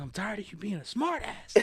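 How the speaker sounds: high-pitched mock rant of a fed-up girl; imitated going off. Girl fed up with his sarcasm, mock going off. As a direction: mimic her outburst in a put-on high voice. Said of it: "She just went off on me".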